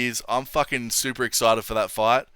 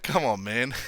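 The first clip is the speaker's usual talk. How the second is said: ordinary delivery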